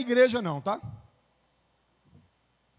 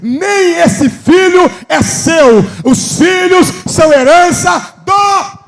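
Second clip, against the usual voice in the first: full force preaching; 4 semitones higher, 14 dB louder than usual. Acted. Preacher to the church about children as God's heritage, in a loud building preaching cry. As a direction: pound the words out to the congregation.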